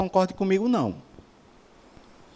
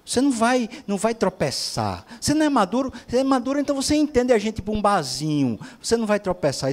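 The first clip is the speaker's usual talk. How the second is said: raised, insistent quoted voice; imitated cajoling partner; 6 semitones higher than usual. One of a couple coaxing the other, imitated in a high voice. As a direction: mimic a partner urging the other on, higher pitch. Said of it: "Isso é um casal conversando".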